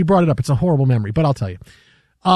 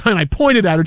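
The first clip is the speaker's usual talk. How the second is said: ordinary delivery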